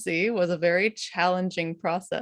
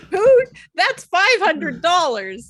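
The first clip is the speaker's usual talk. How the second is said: high, loud, drawn-out disbelief; incredulous outcry; 11 semitones higher, 6 dB louder, slower than usual. Real, not acted. Hearing a paid sound was cut, incredulous outcry. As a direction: protest it loudly, pitched way up.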